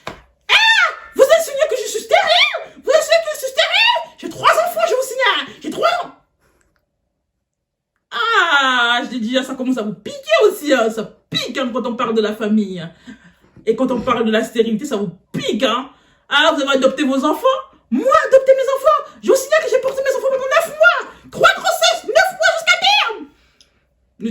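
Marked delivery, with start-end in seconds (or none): 0.1-6.2 much higher, laughing mock shriek; outraged teacher. Offended teacher protests about her children, high laughing shriek. 17.9-23.3 much higher, laughing mock shriek; outraged teacher. Offended teacher protests about her children, high laughing shriek.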